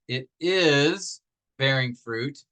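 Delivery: "is" held long and set apart; stress on IS. Shown as a demonstration. Teacher showing the added verb of being, stress on "is". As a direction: draw out "is", then a small pause. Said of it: "in this case is".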